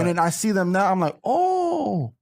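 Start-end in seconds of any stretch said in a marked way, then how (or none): 1.2-2.1 high pitched sudden realization; surprised oh. Retold or imitated. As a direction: jump up in pitch at the discovery. Realizing friend was right.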